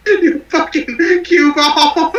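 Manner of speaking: slow line broken by laughter; laughing. Real, not acted. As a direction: laugh through the insult. Cracking up mid-line.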